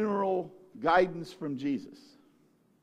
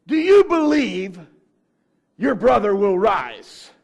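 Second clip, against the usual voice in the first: slow, loud question; 11 dB louder, slower than usual. Retold or imitated. Preacher voicing Jesus asking grieving sisters, slow loud. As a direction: press the question home. Jesus asks Mary and Martha.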